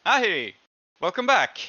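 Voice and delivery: high drawn-out hello; Haxic greeting. Acted. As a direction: greet her warmly as Haxic. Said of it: "Haxic looks up and goes".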